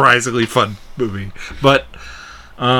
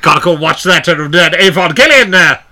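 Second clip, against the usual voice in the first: louder, higher hammed-up explorer voice; goofy British adventurer impression; Nigel Thornberry; 6 semitones higher, 5 dB louder than usual. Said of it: "Like Nigel Thornberry had a stroke".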